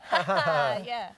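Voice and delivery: bursting out laughing mid-joke; loud real laugh breaking the reading. Real, not acted. A mother cracking up at her son's joke, laughing.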